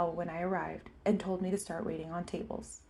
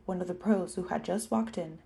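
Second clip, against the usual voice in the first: ordinary delivery, nothing marked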